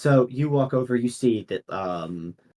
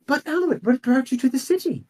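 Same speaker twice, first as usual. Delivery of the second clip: high, bright question; curious noble lady; 13 semitones higher than usual. Acted. Noble lady asks the priest why he came, high and curious. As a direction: ask eagerly, pitched well up.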